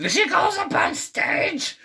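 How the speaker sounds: shrieky put-on falsetto narration; high cartoon voice. Telling how Marge goes up on stage, high shrieky voice. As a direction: squawk it very high.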